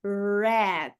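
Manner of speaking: "Rat" with a pulled English R, low; strong English R. Shown as a demonstration. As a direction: pull the R hard in "Rat". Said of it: "Rato a gente diz rat".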